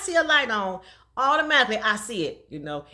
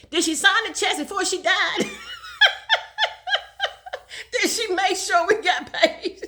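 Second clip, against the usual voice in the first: laughing mock boss; 9 semitones higher than usual. Boss demanding checks.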